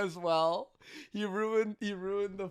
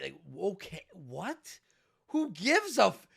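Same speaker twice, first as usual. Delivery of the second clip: ordinary talk, nothing marked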